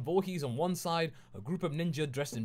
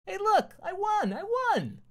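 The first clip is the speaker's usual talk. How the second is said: much higher, louder gleeful yell; 8 dB louder, slower than usual. A narrator's reading. Deadpool jumping up and down, yelling much higher that he won. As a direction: shout the win like an overjoyed kid. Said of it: "yelling that over and over".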